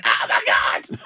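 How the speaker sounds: breathy startled gasp of a woman on the tube; shocked commuter gets sprayed with water; startled passenger. Retold or imitated. Surprised cry. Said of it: "and women went".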